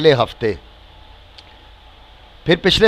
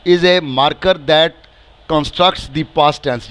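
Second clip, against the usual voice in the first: slow read English; much slower than usual. Shown as a demonstration. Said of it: "ये भी कह रहे हैं".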